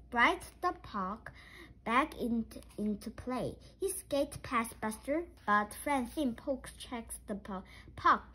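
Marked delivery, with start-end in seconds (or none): none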